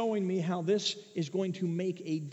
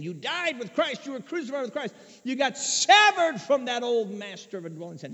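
rising preaching; 6 semitones higher, 7 dB louder than usual. Real, not acted. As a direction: pitch lifted high, voice swelling louder. Preaching builds to a peak.

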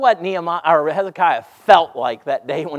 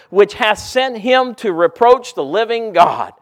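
loud, high, ringing sermon voice; full-force preaching; 6 semitones higher, 9 dB louder than usual. Acted. Preacher reads Hezekiah's prayer to the congregation, full-force preaching. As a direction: lift the scripture like a fiery preacher.

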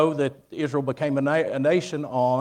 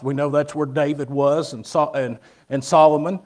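preaching; 6 dB louder than usual. Acted. Preaching to the congregation.

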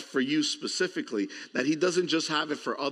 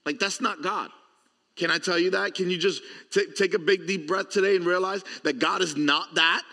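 ordinary delivery

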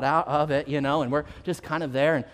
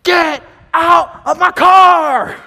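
slow, furious bellow, much higher and louder; angry shout; 10 semitones higher, 14 dB louder, much slower than usual. Retold or imitated. Car owner yelling at intruders in his car, furious shout. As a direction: roar it at the strangers sitting in your car. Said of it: "this angry voice getting closer".

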